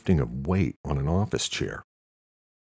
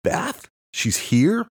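high-pitched, startled disbelief; shocked outburst, pitched way up; blurted out; 11 semitones higher, 4 dB louder, slower than usual. A narrator's reading. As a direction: blurt it out, rising to a loud question. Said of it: "Luke blurted".

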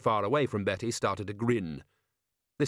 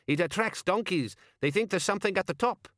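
high-pitched bystander offering a theory; donkey-theory bystander; 10 semitones higher than usual. A narrator's reading. Bystander explaining to the crowd why donkeys climb, high-pitched.

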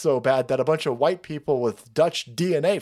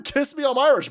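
mock cheer; 9 semitones higher than usual. Reading a shirt slogan.

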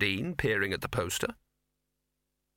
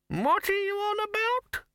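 put-on high, quavering old wizard; creaky doddering old man, high pitched; slower than usual. Poons voice.

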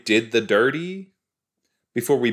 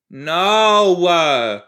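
loud, drawn-out higher-pitched "no"; dismayed shout; 8 semitones higher, 9 dB louder than usual. Real, not acted. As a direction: cry out in horrified protest at the screen. Reacting to a character being killed.

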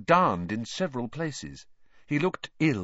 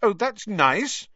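high, slow sarcastic huff; miffed little sister, high-pitched; huffy; 12 semitones higher, 4 dB louder, slower than usual. A narrator's reading. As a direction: draw it out in offended sarcasm. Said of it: "said Ginny huffily".

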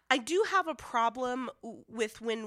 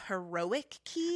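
air quotes; slower than usual. Real, not acted. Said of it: "I'm doing air quotes as I say that".

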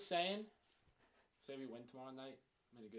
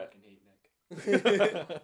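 slow, loud laughing mock hate; 12 dB louder, much slower than usual. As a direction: declare it through laughter.

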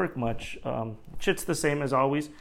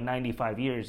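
usual speaking voice

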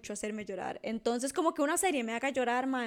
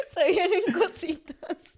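laughing tease; 5 semitones higher, 4 dB louder, much slower than usual. Real, not acted. Teasing laugh about singing.

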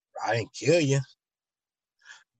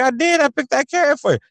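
much higher, louder defensive protest; defensive whine; 13 semitones higher, 7 dB louder than usual. Acted. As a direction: insist you already helped. Helper defending himself.

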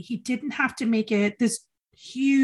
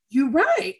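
laughing agreement; 9 semitones higher, 5 dB louder than usual. Retold or imitated. Conceding to friend, then laughing.

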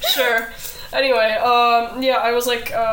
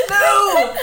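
laughing shout; 12 semitones higher than usual. Pretend. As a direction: yell it while cracking up. Laughing mock protest shout.

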